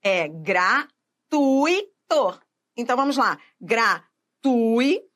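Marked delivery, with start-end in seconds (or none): 0.3-2.4 "gratuito" very slowly in three beats, gra-TUI-to; syllable-by-syllable pronunciation. Shown as a demonstration. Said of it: "Tem que saber pronunciar". 3.6-5.0 "Gratui" split into gra, then TUI; syllable-by-syllable pronunciation. Shown as a demonstration. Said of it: "Tem que saber pronunciar".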